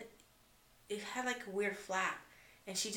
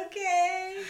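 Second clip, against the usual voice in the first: much higher, small giving-in voice; meek okay; 12 semitones higher, 8 dB louder than usual. Retold or imitated. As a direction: agree sheepishly like a scolded kid. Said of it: "I was like".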